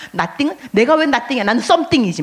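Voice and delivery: hammed-up proud believer; mock arrogance. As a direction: brag like someone who thinks they're special. Voicing an arrogant churchgoer.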